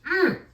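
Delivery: one high pleased hum; a sudden delighted mm; tasting hum. Real, not acted. Tasting with honey.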